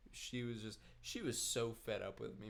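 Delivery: ordinary talk, nothing marked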